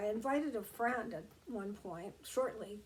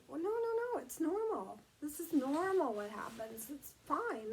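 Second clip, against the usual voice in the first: higher-pitched, emphatic dismissal of a worry; retold friend insisting, pitch lifted; friend's quick reassurance; 5 semitones higher than usual. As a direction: brush the worry away, acting out a friend. Friend reassuring her, retold with animation.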